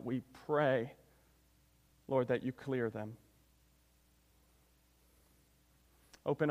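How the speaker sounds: prayer. Real, not acted. Preacher asks God to open the church's eyes, hushed prayer. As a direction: pray quietly and reverently, leaving space between petitions.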